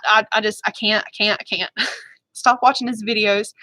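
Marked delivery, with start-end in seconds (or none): none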